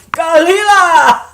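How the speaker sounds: high, drawn-out yell of "Galila"; mocking holler. Teasing the man with her friend's word, mocking holler. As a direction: taunt loudly in a mock Spanish accent. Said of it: "if I see him".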